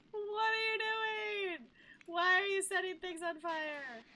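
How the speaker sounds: high, loud, panicked questions; alarmed shouting. Real, not acted. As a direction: yell at the screen in disbelief. Sims setting things on fire.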